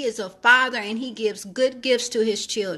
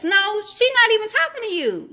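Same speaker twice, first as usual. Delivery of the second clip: sassy retort; 9 semitones higher than usual. Acted. Preacher mocks the disciples.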